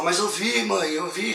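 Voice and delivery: imitating a child. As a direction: plead like a kid nobody believes. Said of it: "tipo criança".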